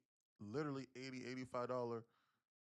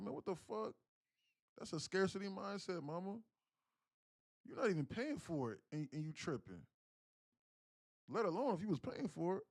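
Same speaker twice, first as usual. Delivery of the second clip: retold scolding; 7 semitones higher than usual. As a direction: scold her for the scarcity mindset. Scolding mama about towels.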